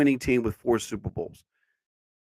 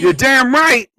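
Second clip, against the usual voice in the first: high shouted soldier yell; loud gung-ho soldier; 8 semitones higher, 5 dB louder, slower than usual. Acted. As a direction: scream it like a recruit. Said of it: "Yes, drill sergeant".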